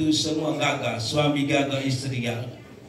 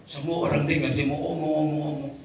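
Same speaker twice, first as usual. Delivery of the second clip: ordinary talk, nothing marked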